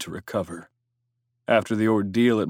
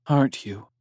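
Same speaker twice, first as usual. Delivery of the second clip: soft female voice; 3 semitones higher than usual. A narrator's reading. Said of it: "she whispered".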